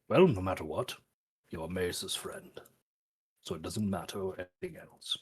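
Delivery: low, soft and unbothered; understated, low-key reassurance; matter-of-fact. Acted. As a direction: say it casually, dropping away quietly. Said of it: "non-matter of factly and say".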